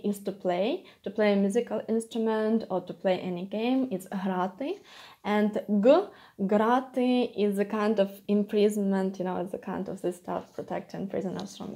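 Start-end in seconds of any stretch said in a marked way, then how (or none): 4.1-4.8 грати said "hraty", with no hard g; soft voiced h. Shown as a demonstration. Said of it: "how you pronounce the letter". 5.5-7.3 грати said "graty"; hard g. Shown as a demonstration. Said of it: "how you pronounce the letter".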